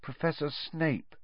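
timid pupil confessing very quietly; barely above a whisper. A narrator's reading. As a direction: let it out softly, almost whispered. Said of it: "in barely more than a whisper".

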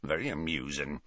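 very low, soft grumble; Hagrid growling. A narrator's reading. Hagrid unamused by Harry's comeback, low growl. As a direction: growl it sourly and quietly. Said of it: "growled Hagrid".

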